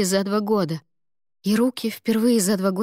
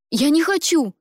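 shouted refusal; 8 semitones higher, 5 dB louder than usual. Acted. Ada refuses.

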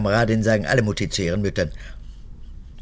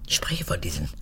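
whisper; 6 semitones lower, 7 dB quieter than usual. Acted. Old guard confides in daughter.

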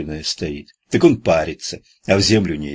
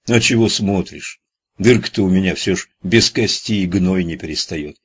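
ordinary delivery

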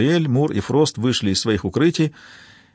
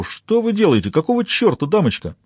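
high-pitched indignant protest; raised-pitch outraged complaint; indignant tramp; 7 semitones higher than usual. A narrator's reading. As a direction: protest as an affronted vagrant. Tramp shoves off her hand.

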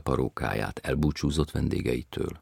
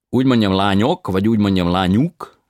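foreign tenor voice; 6 semitones higher, 12 dB louder than usual. A narrator's reading. Tenor asks which form.

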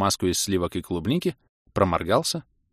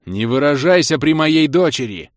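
loud, higher yell at full throat; 6 semitones higher, 7 dB louder than usual. Acted. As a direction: bellow it to stop the swearing. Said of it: "орать во всю глотку".

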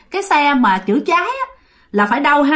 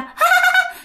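ghost's shrill laugh. Retold or imitated. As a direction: cackle down the phone line. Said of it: "Mà cười há há há". (tiếng ma cười the thé)

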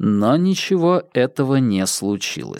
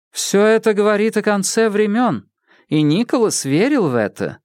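woman character voice; 7 semitones higher than usual. Acted. Alexandra speaks.

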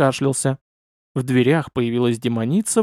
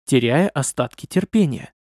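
plain reading voice

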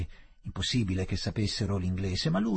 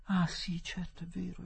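hushed, high, whispery realisation; very soft, higher-pitched recognition; dawning relief; 8 semitones higher, 8 dB quieter than usual. A narrator's reading. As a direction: let relief dawn almost under the breath. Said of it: "esclamò Adela con grande sollievo".

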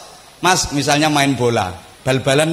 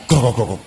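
low crackling radio noise; imitated radio static; 4 semitones lower than usual. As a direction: mimic a broken radio crackling. (tiruan bunyi radio kemresek)

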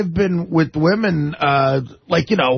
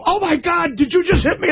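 much higher, outraged mock gasp; mock shocked girlfriend; 12 semitones higher than usual. Retelling a girlfriend's accusation, mock outraged and high. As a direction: play her indignant and shrill-pitched high. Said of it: "they'll go".

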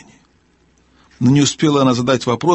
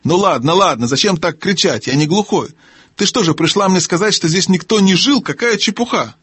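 higher-pitched grumpy complaint; testy gnome, indignant; 7 semitones higher than usual. Acted. Gnome complaining to the owl about nonsense, indignant. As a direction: scold in a high gnome voice.

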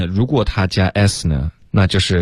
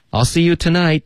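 much higher-pitched, brisker model sentence; natural-speed demo; 10 semitones higher than usual. Shown as a demonstration. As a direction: say it connected and high, as in real use. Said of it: "我们请老师来先示范一下". (自然语速、音调较高地连读)

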